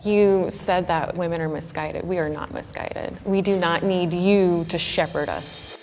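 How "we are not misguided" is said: usual speaking voice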